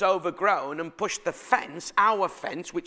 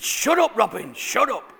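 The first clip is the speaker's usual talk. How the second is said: retold urgent hushing; 3 semitones higher, 4 dB louder than usual. Embarrassed father hushing his child.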